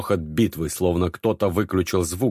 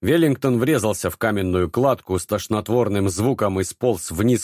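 plain reading voice

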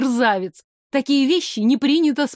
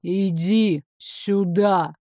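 slow, low displeased command; slow, low and cross; 3 semitones lower, much slower than usual. Acted. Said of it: "отчётливо слышалось недовольство".

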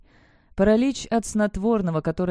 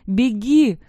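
high, loud urgent yell; full-voiced cry to flee; 5 semitones higher, 8 dB louder than usual. A narrator's reading. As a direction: scream the warning at them. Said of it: "вновь заорала я".

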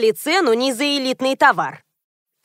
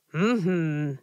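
low, soft mumbled hum; muttered; 6 semitones lower, 7 dB quieter than usual. A narrator's reading. Anatoly takes in the correction, muttering. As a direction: mumble it under your breath. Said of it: "пробормотал Анатолий".

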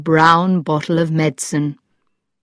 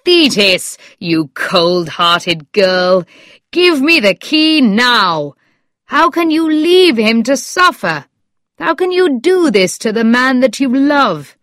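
reproachful cry; 6 semitones higher than usual. A narrator's reading. Doctor demanding the key from the girl, crying out. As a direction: rebuke with mounting, high-pitched urgency. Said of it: "so I cried".

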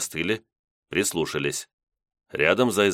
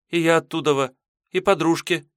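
put-on girl voice; 10 semitones higher than usual. Maiden answers.

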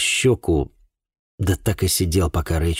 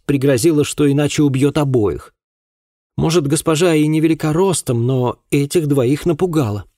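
put-on higher, forceful voice for a girl telling of a fight; agitated girl witness; 7 semitones higher, 4 dB louder than usual. A narrator's reading. Girl recounts how her mistress saved her, agitated. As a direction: tell it urgently as a young woman, pitched up.

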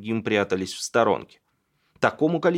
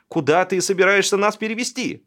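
high-pitched yelled protest; indignant wail; 8 semitones higher, 4 dB louder than usual. A narrator's reading. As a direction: wail at the man moving you out. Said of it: "вопила женщина". (возмущённый вопль)

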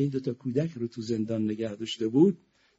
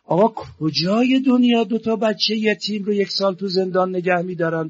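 ordinary talk, nothing marked

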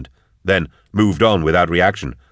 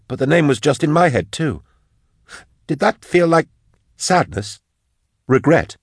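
high-pitched wondering voice; agitated, puzzled Drusha; 9 semitones higher than usual. Acted.

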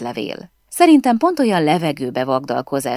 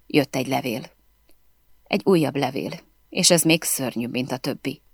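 ordinary delivery, nothing marked

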